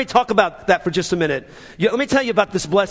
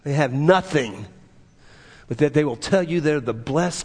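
ordinary talk, nothing marked

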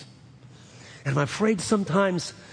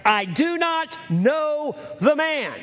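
cursing denial; 10 semitones higher, slower than usual. Acted. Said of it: "began to curse and swear".

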